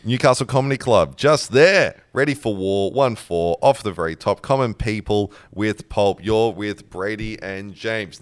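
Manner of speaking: low, slow radio announcer; radio host read. Acted. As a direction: lay back and announce the station.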